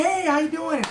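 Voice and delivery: eager, upbeat hi to a friendly face; happy greeting. Retold or imitated. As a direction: greet a smiling client with energy. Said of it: "If she was smiling".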